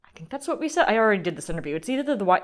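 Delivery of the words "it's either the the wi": ordinary talk, nothing marked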